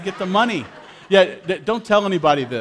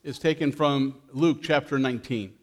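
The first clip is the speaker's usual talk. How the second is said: gentle storyteller reading Luke to the church; scripture reading; 4 semitones lower, 4 dB quieter than usual. A narrator's reading. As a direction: read the story to the congregation. Reads scripture story to congregation.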